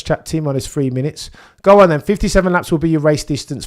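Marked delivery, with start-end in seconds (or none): 1.6-3.7 much higher and louder, revved up; pre-race hype. Real, not acted. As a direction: nerves turning into excitement.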